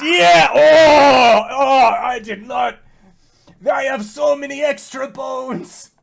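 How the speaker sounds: very loud, high acted yelling; transformation screaming. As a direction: scream through a body suddenly changing shape. Elkhorn screams while transforming mid-fight.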